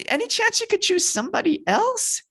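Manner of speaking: very high, squeaky wheedling; hammed-up sheepish request; timid plea to back out. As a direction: ask meekly, way up in pitch. Moses timidly trying to back out.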